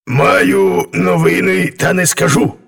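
grumbly, self-important lion voice; grumbling lion. A narrator's reading. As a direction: grumble as a lion withholding a secret. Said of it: "пробурчав лев".

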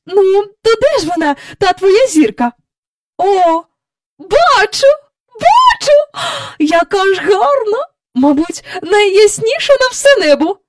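high, loud delighted woman; excited marvelling. Acted. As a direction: gasp at the sight and summon the children. Mother marvels through the telescope.

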